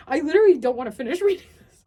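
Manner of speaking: fast, loud laugh-through; giggling despair; laughing while protesting; laughing exasperation. Real, not acted.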